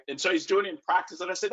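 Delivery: ordinary talk, nothing marked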